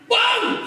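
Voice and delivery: high, sudden imitated blast; explosive high-pitched bang; cannon bang. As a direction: mimic a cannon going off.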